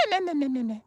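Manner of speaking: high soft imitated cackle "meh, meh"; mimicked cackle. Streamer imitating a cackle he heard in the woods. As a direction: copy the woman's cackle. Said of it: "Like a cackle".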